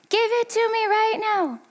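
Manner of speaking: very high-pitched mock kid tantrum; put-on tantrum voice, held high; imitated yelling child. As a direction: mimic a child wailing a demand. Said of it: "And he's yelling".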